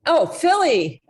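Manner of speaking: ordinary delivery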